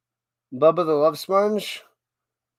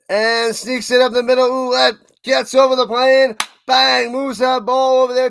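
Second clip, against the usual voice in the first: louder and higher, building to the score; excited play-by-play; 7 semitones higher, 7 dB louder than usual. Real, not acted. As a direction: sell the touchdown to the viewers. Play-by-play touchdown call.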